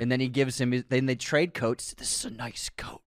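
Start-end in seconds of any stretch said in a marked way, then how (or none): 2.0-3.0 whispered. Retold or imitated. Quoting homeless man.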